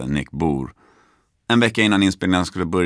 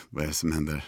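soft, low, stunned mutter; bewildered aside; 4 semitones lower, 10 dB quieter, faster than usual. Retold or imitated. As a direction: say it under your breath, baffled. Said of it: "jag är så här". (lågt, förbluffat mumlande)

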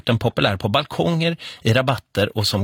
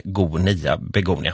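"begonia" said lower, split into "B, go, nia"; revealing the pun; 7 semitones lower, slower than usual. Shown as a demonstration. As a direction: land the punchline "begonia" low and slow. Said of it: "översättas så här".

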